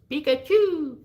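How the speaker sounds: very high, squeaky creature cry; Pikachu. Acted. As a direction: chirp the name out loudly like a little cartoon monster. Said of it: "Pikachu and Eevee don't have a voice".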